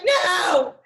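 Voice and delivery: high, loud mock "no"; child's protest yell. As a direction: yell like a kid refusing. Child refusing wet sinks, retold.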